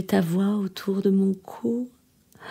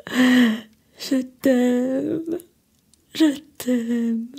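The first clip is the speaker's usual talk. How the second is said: tearful love; 4 semitones higher, much slower than usual. Acted. Final farewell to lover.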